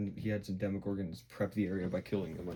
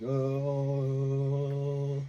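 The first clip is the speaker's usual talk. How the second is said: a high, loud, airy "Oh" like a god; celestial being; 7 semitones higher, 9 dB louder than usual. Retold or imitated. Will in a trance with eyes rolled back, a high and loud celestial "Oh". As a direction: float the word out, otherworldly and high. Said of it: "he's like".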